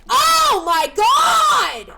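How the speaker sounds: high, loud, very drawn-out yell; long, high-pitched outburst; exasperated shout. Real, not acted. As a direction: wail it slowly in disbelief. Outburst at chat misunderstanding.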